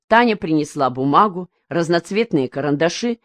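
ordinary delivery

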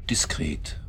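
breathy one-word whisper; hushed, secretive whisper. Acted. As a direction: drop to a whisper, discreet. Hushed aside in a tense confrontation.

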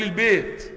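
البيت said as "bēt", the ay leaning to a long e; imala pronunciation. Shown as a demonstration. Teacher showing students how Egyptians say البيت, with the vowel leaning to e. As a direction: tilt the vowel of البيت toward e, bayt becoming bēt. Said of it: "يقول البيت".

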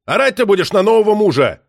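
loud, high-pitched outburst; angry bellow. A narrator's reading. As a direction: roar it at your ex-wife. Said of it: "рявкнул, сорвавшись".

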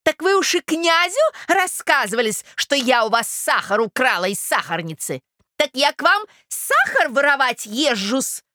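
high, indignant accusing voice; affronted provincial lady. Acted. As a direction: demand an answer as an insulted gossip. (оскорблённая дама, высоко и возмущённо)

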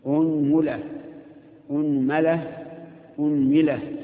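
أنملة three times, hamza with u, the mim as u, a, i in turn; unmula, unmala, unmila, one after another; pronunciation demo. Shown as a demonstration. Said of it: "ضم الهمزة وثلث في الميم". (أنملة بضم الهمزة وتثليث الميم)

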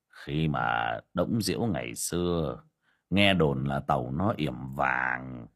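ordinary delivery

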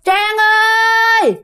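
high eerie call of the name; ghost calling name. Retold or imitated. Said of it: "nghe tiếng kêu mà nó kéo dài ra như vậy". (tiếng ma gọi tên, giọng cao)

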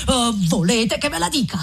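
ordinary delivery, nothing marked

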